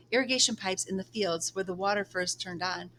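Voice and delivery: plain reading voice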